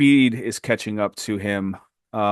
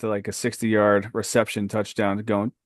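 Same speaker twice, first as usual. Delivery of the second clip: ordinary talk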